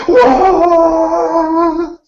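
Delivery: very high mock shriek; imitated boat-horn scream. Teen girl on the bridge imitating a boat horn, high shriek. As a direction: wail like a foghorn, way up high. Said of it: "she's, like, screaming".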